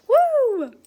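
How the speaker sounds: high, loud whoop; excited cheer. Real, not acted. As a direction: cheer it out for the holiday. Cheering Saint Patrick's Day.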